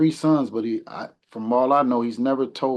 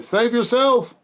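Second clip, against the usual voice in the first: much higher, louder mock cry of a fleeing father; imagined dad's cry; 12 semitones higher, 6 dB louder, slower than usual. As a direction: shout it up high as if escaping a fire. Father fleeing burning building, imagined.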